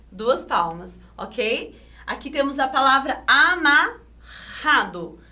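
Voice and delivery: ordinary talk, nothing marked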